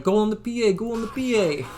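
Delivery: high-pitched, insistent imitation of a teammate's call; emphatic. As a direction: urge the team on, high and emphatic. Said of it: "emphatically, like, saying this".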